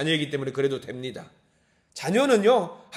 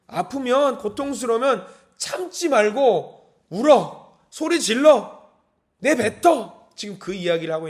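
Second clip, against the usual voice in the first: louder, higher, slow insistent urging to cry out; urging God's voice; 4 semitones higher, 4 dB louder, slower than usual. Acted. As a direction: urge them to let it out, slowly. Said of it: "하나님이 말씀하시는 것 같죠?". (울라고 다그치듯 크고 느리게)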